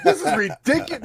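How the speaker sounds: laughing mock-entitled Karen, pitched high; a light laughing take on a demanding customer; Karen impression. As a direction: play the outraged customer half-jokingly. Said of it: "I almost pulled a Karen".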